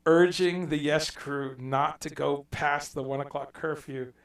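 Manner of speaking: ordinary talk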